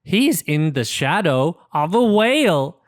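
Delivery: ordinary delivery, nothing marked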